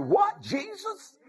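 very high, astonished squeal of a question; excited blind man. Acted. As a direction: react in amazed disbelief, pitch shooting up. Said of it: "Blind man got excited".

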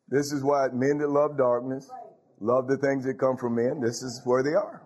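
ordinary delivery

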